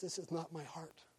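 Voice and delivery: hushed, very soft confession; imitated grieving father. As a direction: play a crying father quietly, with restraint. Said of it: "the father in the corner crying".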